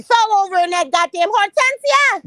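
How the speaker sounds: high, loud mock wailing. Vlogger mocking a grieving woman with a loud wail. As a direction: wail the name like a hammed-up mourner.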